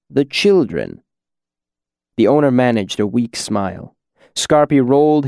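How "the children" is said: unpleasant edge. A narrator's reading. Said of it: "voice had an unpleasant edge".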